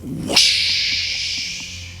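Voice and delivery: breathy whoosh sound effect; voiceless rushing water whoosh. Retold or imitated. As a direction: imitate the wave rushing up the sand. Imitating wave rushing up beach.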